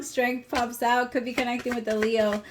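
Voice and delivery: ordinary talk, nothing marked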